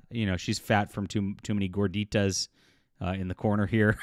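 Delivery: ordinary talk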